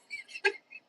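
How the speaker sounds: short high burst of laughter; quick high chuckle; real laugh. Real, not acted. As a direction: laugh at the memory. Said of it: "it's hilarious".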